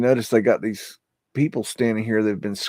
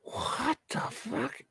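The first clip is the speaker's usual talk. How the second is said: whispered; 13 semitones higher, 10 dB quieter, much slower than usual. Real, not acted. Creeped out by archive gif.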